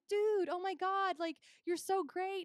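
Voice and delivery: high, slow, gushing fan. Retold or imitated. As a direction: gush over him. Gushing at driver.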